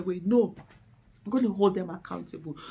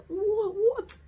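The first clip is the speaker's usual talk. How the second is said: exasperated disbelief; 12 semitones higher than usual. Retold or imitated. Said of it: "You're like".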